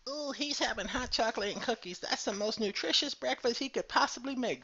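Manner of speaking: snarky viewer mocking his breakfast. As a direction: tease him like a snarky commenter. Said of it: "Don't worry about my nutrition".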